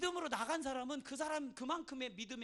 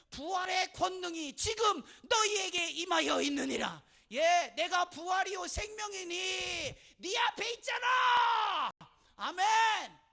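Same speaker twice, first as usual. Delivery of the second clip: high, slow shouted proclamation; impassioned pulpit shout climbing to a peak; full-force preaching; 8 semitones higher, 12 dB louder, slower than usual. Real, not acted. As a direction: thunder it out, stretching the final words.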